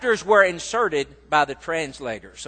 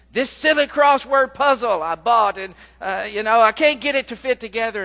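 put-on higher and louder fretful old mother; upset mom; 4 semitones higher, 5 dB louder than usual. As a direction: complain like the flustered mother. Upset mother complaining to son.

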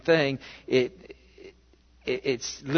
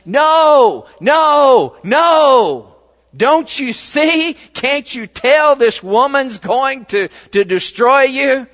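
loud, much higher, desperate no no no; shouting warning; 8 semitones higher, 10 dB louder, slower than usual. Pretend. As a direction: yell at Samson to stop him. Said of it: "I'm backing up saying".

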